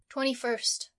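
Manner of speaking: plain reading voice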